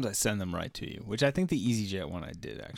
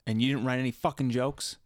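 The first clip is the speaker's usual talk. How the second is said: normal talking voice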